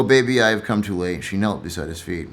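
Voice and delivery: normal talking voice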